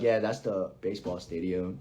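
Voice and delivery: low, soft, matter-of-fact answer; deadpan local. Retold or imitated. Local answering a curious visitor, low and deadpan. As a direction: drop the voice low and quiet, unimpressed.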